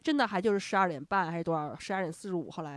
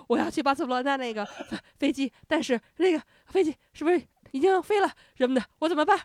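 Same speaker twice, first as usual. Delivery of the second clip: panicky, breathless plea, a bit higher and louder; 上气不接下气地央求; 5 semitones higher, 5 dB louder than usual. Retold or imitated. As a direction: gasp it out like you just sprinted to the desk. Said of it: "你慢点儿，你慢点儿，慢慢说，喘口气儿". (气喘吁吁、慌张地抬高声音求助)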